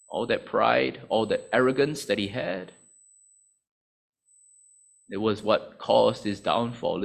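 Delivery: ordinary delivery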